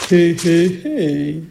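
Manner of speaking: ogress's drawn-out chuckle; muttering ogress. A narrator's reading. Ogress gloating at grandmother's door, muttered chuckle. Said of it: "marmonne Logresse".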